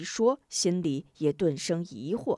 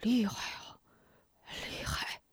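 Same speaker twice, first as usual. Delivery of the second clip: soft self-talk fading to a whisper; muttering to himself; 3 semitones higher, 10 dB quieter than usual. A narrator's reading. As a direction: murmur it to yourself, awestruck. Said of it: "不由自语". (低声自语)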